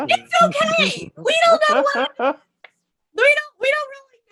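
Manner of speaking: high, loud, pushed-up reassurance; strained upbeat. Real, not acted. Telling viewers about her blood test, strained high and loud. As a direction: force it out bright over the worry.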